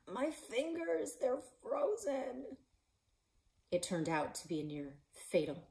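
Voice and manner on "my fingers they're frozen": strained shout. Acted. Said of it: "using the last milligram of energy to shout".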